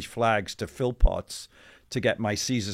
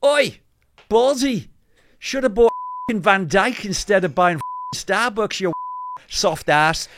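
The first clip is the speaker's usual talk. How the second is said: put-on loud, much higher, jeering street heckle in a Scouse accent; mock Scouse shout; 10 semitones higher, 8 dB louder than usual. Passing Scouser heckling the CEO over Van Dijk, mock Scouse shout. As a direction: bellow it across the cobbles like a cheeky Liverpool fan. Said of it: "and he goes".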